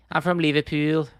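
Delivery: mock Liverpool accent; Scouse accent. Shown as a demonstration. Streamer introducing himself as a Liverpudlian, Scouse impression. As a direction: lay on the Scouse. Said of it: "Scouse impression".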